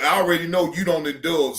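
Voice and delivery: normal talking voice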